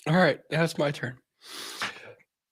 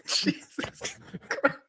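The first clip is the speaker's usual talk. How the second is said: breathless, laughing; whispered through laughter; wheezing laugh; much slower than usual. Real, not acted. Cohost cracking up at reading.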